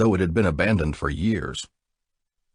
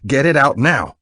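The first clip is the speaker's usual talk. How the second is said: louder, higher urgent order; forceful demand; 8 semitones higher, 7 dB louder than usual. A narrator's reading. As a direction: bark the order, no waiting. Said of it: "he demanded".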